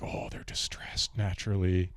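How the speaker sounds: whispered, slow mock buyer voice; breathy whisper of an eager foreign buyer. Friend quoting buyers of jeans, whispered and slow. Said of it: "And people are like".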